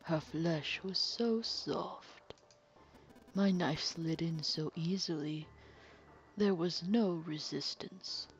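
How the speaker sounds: soft, slow, smug villain voice; calm sinister villain. Acted. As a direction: purr the confession like a cold killer. Villain relishes the murder.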